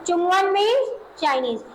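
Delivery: normal talking voice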